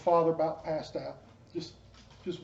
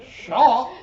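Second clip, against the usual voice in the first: high, loud, put-on shocked yelp; jokey gasp of shock at the ministry question; mock shock; 5 semitones higher, 7 dB louder than usual. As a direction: play the shock big and silly. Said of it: "And I'm like".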